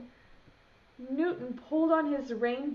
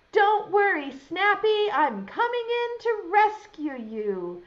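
high, louder reassuring call; 7 semitones higher, 6 dB louder than usual. Acted. Newton calling into the cupboard to Snappy.

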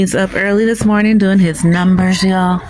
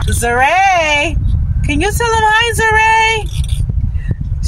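much higher, sing-song coaxing; coaxing grandchild; 10 semitones higher, much slower than usual. Real, not acted. Grandmother coaxing her granddaughter to say hi, sing-song.

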